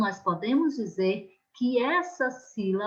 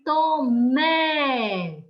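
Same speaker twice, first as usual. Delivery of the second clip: Tomé with a long, held mé; stress on final syllable; 3 semitones higher, 3 dB louder than usual. Shown as a demonstration. Teacher shows the strong last syllable, mé held long in Tomé. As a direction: stretch the mé of Tomé right out. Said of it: "a sílaba forte aí na última".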